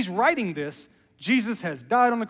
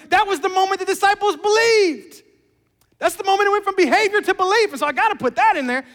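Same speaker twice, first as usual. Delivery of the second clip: much higher, louder and fast, tumbling out; excited rush; 10 semitones higher, 7 dB louder than usual. Acted. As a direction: pile the ideas on in a rush of joy. Preacher acts John's excited planning.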